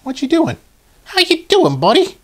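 very high squeaky toy character voice; put-on high-pitched cartoon greeting; Beachcomber. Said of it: "that's gonna be my voice for him".

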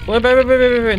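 high, loud panicked yelling; panicked shouting. Real, not acted. Player fleeing angry ants, panicked loud shouting. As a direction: yell it as the ants swarm you. Ants attack after egg grab.

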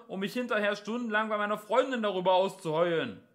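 high, loud policeman's holler; shouted, condescending warning; megaphone voice. A narrator's reading. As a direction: blare it out as if through a megaphone. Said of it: "sagte die Megafonstimme".

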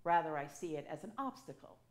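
usual speaking voice

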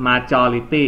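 "majority" again, very slowly and clearly; repeated model reading. Shown as a demonstration. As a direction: drag out each syllable of "majority" for the class. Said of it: "again please". (อ่าน "majority" ซ้ำอีกครั้ง ช้ามาก)

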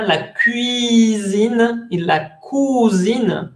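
slow "la cuisine y la cousine", kwee versus koo; contrast pronunciation. Shown as a demonstration. Teacher slowly contrasts the two French words for students. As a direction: stretch out "la cuisine y la cousine" so both vowels differ. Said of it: "No se pronuncian igual".

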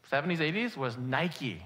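normal talking voice

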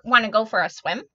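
loud, lightly voiced duck; duck character. A narrator's reading. Duck inviting the spider to swim, lightly voiced and loud. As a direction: call out a loud, playful invitation. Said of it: "called the duck".

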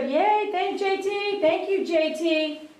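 high, slow, delighted thank-you; sing-song gratitude for a tip; excited thanks. Real, not acted. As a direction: beam it up to the tipper. Thanking a tipper.

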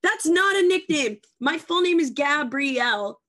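loud, high-pitched protest; comic indignation. Real, not acted. Arguing over what counts as a nickname, indignant. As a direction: object loudly, pitch jumping up.

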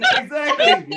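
high, loud, laughing "exactly". Real, not acted. Teller agreeing with friend, high laughing exclaim.

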